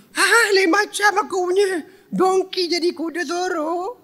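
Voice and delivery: high, slow astonished voice; amazed. Retold or imitated. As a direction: marvel at the change. Halimah amazed.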